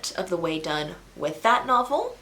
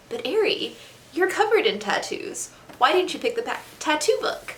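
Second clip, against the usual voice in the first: higher, teasing imitation of the audience; mock viewer voice; 8 semitones higher than usual. Imagining viewers asking why she skipped it, mock high fan voice. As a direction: play a quizzical fan, pitched up. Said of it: "I'm sure you're all like".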